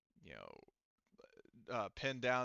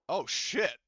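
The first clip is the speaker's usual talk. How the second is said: loud, higher burst of real surprise; startled swear at the table; surprised curse; 7 semitones higher, 11 dB louder than usual. Real, not acted. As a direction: blurt it as the gas goes up. Table reacts to combustible gas.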